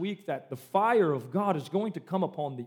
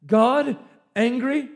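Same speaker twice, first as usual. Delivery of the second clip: slow, loud, disbelieving question, a touch higher; shocked gasp; 4 semitones higher, 8 dB louder, slower than usual. Retold or imitated. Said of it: "causes some of you to gasp".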